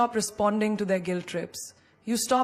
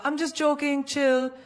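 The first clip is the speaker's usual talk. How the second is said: higher, breezy brush-off; dismissive laugh-off; 5 semitones higher than usual. Retold or imitated. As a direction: shrug it off as if it was nothing. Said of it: "they laugh it off with a".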